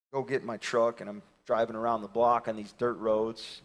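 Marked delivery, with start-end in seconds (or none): none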